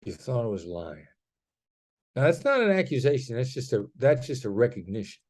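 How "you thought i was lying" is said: "You thought I was lying." soft, lower, falling to a flat end; calm declarative, no edge; gentle statement. Shown as a demonstration. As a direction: state it gently, pitch dropping. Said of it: "I said it gently. downward inflection, declarative".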